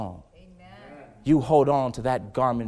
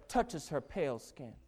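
normal talking voice

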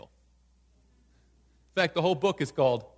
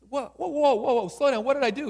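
high, loud panicked whoa; startled Daniel; 9 semitones higher, 7 dB louder than usual. Acted. Daniel at the door, startled by his killer, high and loud. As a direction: back away in alarm, much higher.

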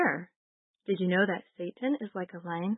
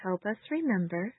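prayer; 3 semitones higher, 3 dB quieter than usual. Acted. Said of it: "you can repeat after me".

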